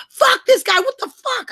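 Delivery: high, loud exasperated outburst; sudden furious high-pitched yell; mock outraged yell. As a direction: yell it out like a fed-up newcomer. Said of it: "I'm like".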